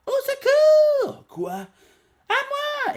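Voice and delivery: very high, drawn-out scream for help; panicked cry for help. Acted. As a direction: shriek for help like a terrified girl. Manga girl cries for help.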